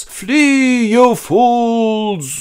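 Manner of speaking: high, drawn-out shout; hammed-up falling cry to escape; Gandalf. Wizard dragged into abyss, long high shout. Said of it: "he shouts".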